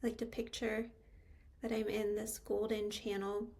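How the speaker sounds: unhurried meditation guide, long pauses between phrases; calm, spacious meditation delivery with lingering pauses; guided meditation. Real, not acted. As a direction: guide them into stillness, phrase by phrase.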